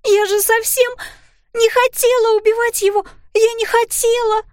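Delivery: put-on high, loud hysterical girl; a child wailing that she didn't mean to kill; sobbing cry. A narrator's reading. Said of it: "Судорожно всхлипывая, она закричала". (высокий громкий истеричный детский крик)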